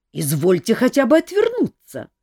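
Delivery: high, loud indignant protest; old wizard objecting to undressing. A narrator's reading. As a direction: demand it with outrage. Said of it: "возмутился мэтр Истран".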